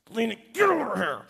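put-on high, strained angry call to a child; stern parental summons. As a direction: re-enact a fed-up dad calling his son over. Father summons misbehaving son.

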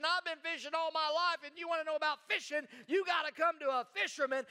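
tough fisherman. Retold or imitated. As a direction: puff up like a proud old fisherman. Tough fisherman Peter boasting.

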